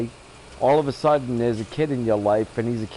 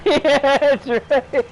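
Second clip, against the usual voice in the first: higher and louder, "Yeah, that's right" cracking up with laughter; laughing; 14 semitones higher, 5 dB louder, much slower than usual. Retold or imitated. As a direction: repeat it while bursting out laughing. Repeats dog's line, cracking up.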